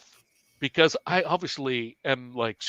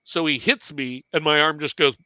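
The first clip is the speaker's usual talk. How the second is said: ordinary talk, nothing marked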